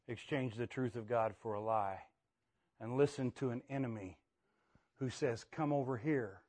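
soft, low solemn preaching; quiet sermon. Acted. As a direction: bring it down to a hush.

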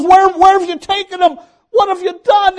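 high, loud, desperate cry of a grieving woman; Mary begging, frantic and high; frantic pleading. Acted. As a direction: plead in panic, voice climbing. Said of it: "she says".

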